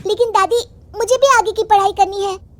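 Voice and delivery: put-on high, loud girl's protest; upset granddaughter. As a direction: object to grandma as an upset child. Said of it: "नाराज होकर बिंदु अपनी दादी से कहती है". (नाराज़ बच्ची की ऊँची आवाज़)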